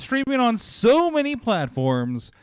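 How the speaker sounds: big, loud, high-energy announcer intro; show opener. Acted. Host kicking off the podcast for listeners, in a loud hype voice. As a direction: hype the audience like a ring announcer.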